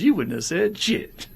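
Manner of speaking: slow, high put-on voice mocking a brag; mocking retort.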